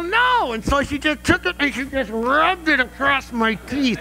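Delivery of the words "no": very high mock refusal; defiant son. Son refusing mom's bar of soap, defiant. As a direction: imitate a boy refusing mom.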